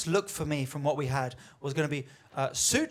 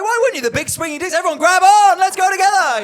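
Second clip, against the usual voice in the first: much higher and louder, swaggering lad voice; macho bravado; 11 semitones higher, 11 dB louder than usual. Pretend. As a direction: puff up like a cocky alpha leading the pack. Mocking the big swinging dicks.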